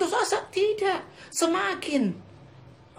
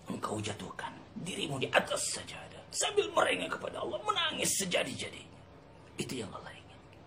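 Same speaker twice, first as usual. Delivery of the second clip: drops to a soft whisper; hushed sermon; 5 semitones lower, 10 dB quieter than usual. Real, not acted. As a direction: draw the listeners close in a whisper. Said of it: "khotbah yang berbisik". (khotbah berbisik lirih)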